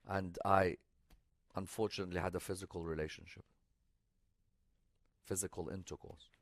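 low, hushed, halting delivery; sombre, subdued storytelling voice; retold shameful confession. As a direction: quote a grave confession softly, with long pauses. Retells the sinner's shameful confession.

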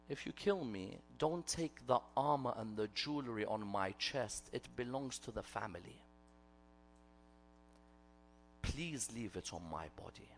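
low, very soft voiced near-whisper; imitated whisper. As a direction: murmur a last request into his ear. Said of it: "whispers in the ear of the person he's fighting".

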